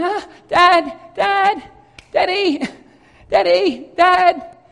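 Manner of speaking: loud high calling for dad. Retold or imitated. Boys searching for hidden dad, calling out. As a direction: call across a playground.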